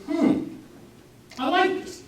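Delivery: high-pitched, intrigued woman sampling something; intrigued Eve. Acted. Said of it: "She took of the fruit thereof and said".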